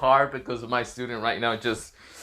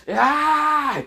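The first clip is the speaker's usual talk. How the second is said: high, loud wordless scream of rage; furious scream; 12 semitones higher, 4 dB louder than usual. Acted. Hiruzen screaming into the air in rage, furious scream. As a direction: howl out pure frustration.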